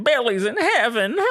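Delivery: high mock old lady yell. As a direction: imitate a frail old woman's shriek. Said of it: "that old lady fucking yelling at me".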